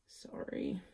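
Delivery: breathy, half-voiced little apology; whispered sorry. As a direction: whisper it like a quick aside. Quick mock apology.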